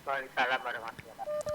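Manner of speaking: distant, faint caller on the phone; far from mic. Real, not acted. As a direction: speak from far away from the microphone. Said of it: "bisa mendekatkan, eh, mikrofonnya".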